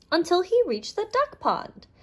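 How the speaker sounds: ordinary delivery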